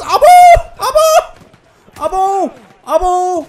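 very high, loud yells; real high-pitched shrieks of shock; startled screaming. Real, not acted. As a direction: build into screamed cries of alarm. Sudden scare in game.

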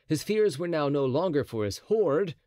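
ordinary delivery

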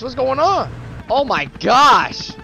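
ordinary talk

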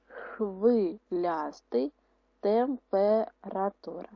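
each word drawn out slowly with a rise; slow dictation. Shown as a demonstration. Teacher dictating words to pupils, slow and drawn out. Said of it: "допоможіть читалочці записати подані слова".

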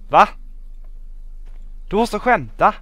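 high, loud protest; exasperated disbelief. Real, not acted. Gamer finds the machine broken, loudly incredulous. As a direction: cry out in disbelief. Machine fails after long trek.

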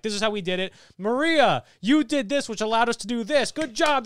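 loud, high hammy speech; villain's exposition. Retold or imitated. Retelling Mysterio's huge exposition, hammed-up and loud. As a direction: imitate a villain grandly crediting the crew. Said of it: "goes on this huge exposition thing".

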